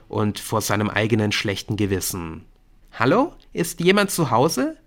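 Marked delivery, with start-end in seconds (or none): 2.9-4.8 put-on high, rising girlish call; needling schoolgirl. A narrator's reading. Brynn tries to get inattentive Nick's attention, high and needling. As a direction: call him back to earth in a high voice. Brynn waves at absent Nick.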